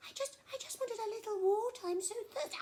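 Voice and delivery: high, pleading little elephant voice; timid elephant. Acted. Trembling elephant begging crocodile for water, high and timid. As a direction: plead nervously, stammering.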